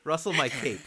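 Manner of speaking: giggling it out; laughing. Real, not acted. Host repeating the cape joke, laughing.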